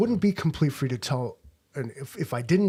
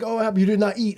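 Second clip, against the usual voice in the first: concerned waiter; 8 semitones higher, 5 dB louder, much faster than usual. Retold or imitated. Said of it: "He's like".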